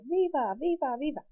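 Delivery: high, mock crowd chant; crowd yelling. Teacher imitating the plaza crowd's chant, high. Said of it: "everybody yells".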